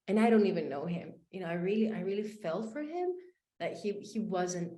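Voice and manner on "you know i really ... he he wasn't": ordinary delivery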